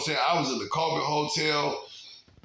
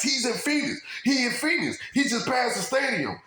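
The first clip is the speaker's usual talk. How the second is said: pitched way up, agitated with a laugh; half-laughing, fired-up retelling; agitated mimic; 11 semitones higher than usual. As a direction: voice shoots higher, excited and amused.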